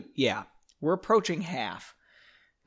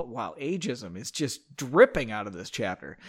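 ordinary delivery, nothing marked

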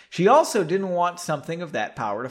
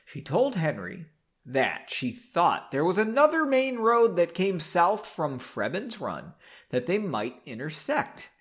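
ordinary talk

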